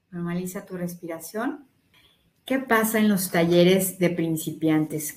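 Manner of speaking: ordinary talk, nothing marked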